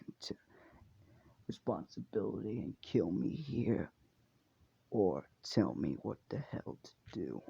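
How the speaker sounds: soft, held-back grieving voice, partly whispered; lightly voiced despairing young demon; Gyutaro's voice. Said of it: "I have to do Uma in his voice".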